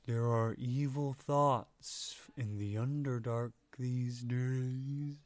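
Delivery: very slow gentle creature voice; slow sad creature. Acted. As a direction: drawl out each word sadly. Flump speaking.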